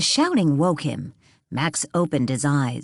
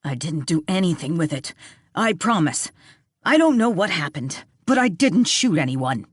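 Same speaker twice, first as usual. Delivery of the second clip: insisting. A narrator's reading. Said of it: "Bart insisted".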